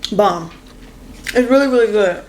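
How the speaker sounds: words round a bite of food; chewing while talking. Real, not acted. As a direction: mouth full. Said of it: "I don't mean to talk while I'm eating".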